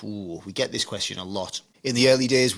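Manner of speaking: normal talking voice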